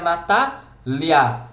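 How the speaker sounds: "Natália" split slowly, pausing after the stressed TÁ; dividido em sílabas. Shown as a demonstration. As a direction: stretch "Natália" into Na-tá, then lia. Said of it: "funciona pela mesma regra". ("Natália" devagar, separando a sílaba tônica)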